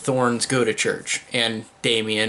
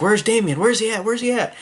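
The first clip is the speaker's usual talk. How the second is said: higher-pitched mock panic, pitch climbing; mock worried searching; 10 semitones higher than usual. Parents looking for Damien at the park, mock panic rising. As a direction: imitate worried parents calling for a lost child. Acts out parents seeking missing boy.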